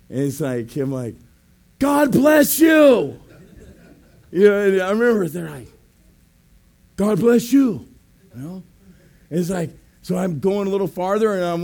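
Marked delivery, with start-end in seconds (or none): 1.8-3.2 loud, high, long-drawn shout; called-out blessing. Retold or imitated. Preacher blessing strangers on the street, shouted out. 7.0-7.9 loud call, mildly raised; called-out blessing. Retold or imitated. Preacher calling to people down the street, a louder call.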